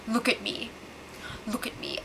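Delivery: soft breathy menace as a joke; hushed joking meme voice; mock dramatic whisper. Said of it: "So Derek goes".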